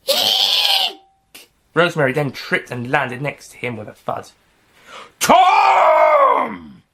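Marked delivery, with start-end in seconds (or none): 0.1-1.5 very high shrieking scream; a mother's horrified shriek. Acted. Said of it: "Tom's mother screamed". 5.2-6.8 high, loud shout of the name; a father screaming for his son; anguished yell. Acted. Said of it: "yelled Tom's father".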